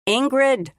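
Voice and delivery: loud, high call down the hole; yelling her name. Acted. Micah yells down the cliff to the drowsy Ingrid, loud. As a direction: shout to jolt her awake. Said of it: "He was yelling at her".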